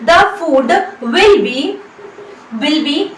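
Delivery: very slow, each word spaced out; slow dictation. Shown as a demonstration. Teacher dictating an English sentence to students, very slow. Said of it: "अब हम यहाँ पर इस्तेमाल करेंगे will का".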